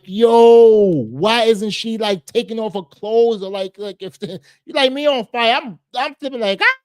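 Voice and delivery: freaking out. Retold or imitated. To viewers, imagining himself on fire, high frantic panic. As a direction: play your own shocked self, shrieking with disbelief. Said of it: "I was like".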